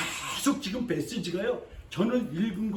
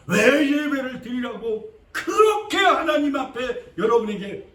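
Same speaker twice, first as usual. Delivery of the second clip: slow, louder proclamation; preaching shout; 3 semitones higher, 6 dB louder, slower than usual. Real, not acted. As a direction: lean into every word.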